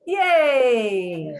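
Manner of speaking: loud, long drawn-out cheer; excited cheer. Real, not acted. Host cheering after a speaker's story, loud excited cheer. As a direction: cheer it out, falling slowly in pitch.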